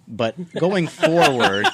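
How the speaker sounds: playfully laboured, stretched-out delivery; drawn-out emphasis. Real, not acted. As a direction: draw it out pointedly through a laugh. Repeats interrupted phrase with mock emphasis.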